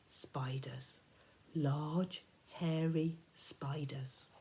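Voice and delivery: soft, slow whisper; eerie whisper. Acted. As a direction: creep her out with a hushed warning. Percy snaps her out of it.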